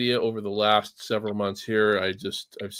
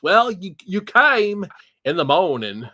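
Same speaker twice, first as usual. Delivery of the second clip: imitating a little girl's Texas drawl, a bit higher and louder; Texas accent; 6 semitones higher, 6 dB louder, slower than usual. Parent imitating his five-year-old daughter's Texas accent. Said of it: "she got that Texas accent down".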